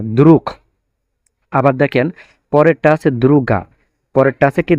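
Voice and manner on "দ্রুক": দ্রুক said with a hard k at the end; final g as k. Shown as a demonstration. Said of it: "উচ্চারণ হবে এখন কয়ের মতো". (দ্রুক, শেষে ক-এর মতো উচ্চারণ)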